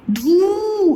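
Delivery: धू with a long held oo; children's long-vowel mistake. Retold or imitated. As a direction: drag धू out slowly. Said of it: "बच्चे क्या कर देते हैं".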